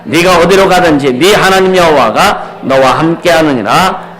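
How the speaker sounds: loud, high, ringing proclamation of scripture; preaching at full force. Acted. Pastor proclaiming scripture to the congregation, loud and high. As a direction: thunder the verses out to the congregation.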